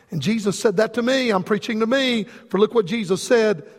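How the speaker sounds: usual speaking voice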